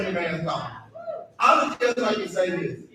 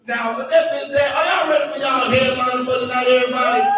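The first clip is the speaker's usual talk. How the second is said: raised hype-man introduction, building; stage announcer; 6 semitones higher, 4 dB louder than usual. Real, not acted. Comic introducing the night's headliner, building announcer shout.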